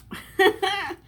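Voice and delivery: high burst of laughter; short amused laugh at the sight; surprised laugh. Real, not acted. Reaction to burger.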